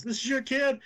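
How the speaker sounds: mock incredulous Carl. Carl asking the mother about her son, mock disbelief. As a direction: feign shock, pitch up.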